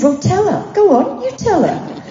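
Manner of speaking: high, swooping encouragement; eager urging. Retold or imitated. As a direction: egg a friend on, pitch leaping up. Urging her friend to compliment a stranger.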